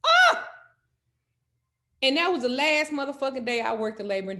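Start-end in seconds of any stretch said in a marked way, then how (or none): 0.0-0.4 a shrill, much higher, loud "Oh!"; outraged cry. Retold or imitated. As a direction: burst out in disgust. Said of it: "Oh, I was mad".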